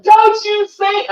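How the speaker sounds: high, drawn-out shout imitating an upset girl; tearful outburst. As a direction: scream it as a desperate demand to stop. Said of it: "tears coming, everything".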